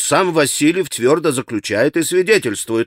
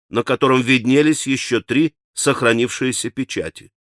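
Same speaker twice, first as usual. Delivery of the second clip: usual speaking voice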